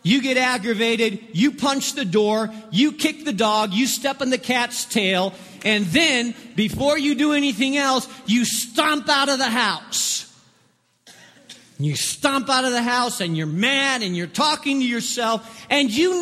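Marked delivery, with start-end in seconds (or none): none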